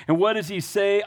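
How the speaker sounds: ordinary talk, nothing marked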